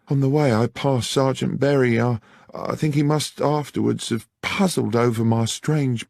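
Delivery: a frightened maid pleading her case; high anxious country lass; young country woman. Acted. Beth tells of the arrest.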